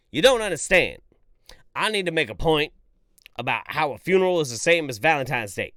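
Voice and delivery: loud mock insistent eulogist; mock speaker. Mocking the funeral speaker, loud and insistent. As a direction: ham up the stubborn speaker. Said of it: "and you're like".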